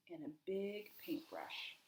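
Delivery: hushed storyteller whisper. A narrator's reading. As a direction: breathe it like a secret plan. Secret plan told softly.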